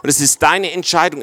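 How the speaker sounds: usual speaking voice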